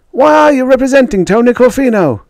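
very high, loud question; reporter calling out. A narrator's reading. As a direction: shout it from a press pack. Reporters call questions to Jake.

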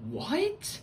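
high rising disbelief; mock-stunned question shooting upward; incredulous. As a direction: squeak it out, baffled. Group reacts in disbelief.

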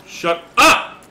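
loud, high outburst; fed-up high holler; exasperated shout. Real, not acted. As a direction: yell it to silence a screamer. Yells at screaming monster.

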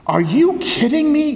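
high incredulous retort; mock-shocked, as if he can't believe it; retold disbelief. Priest's retold surprised retort.

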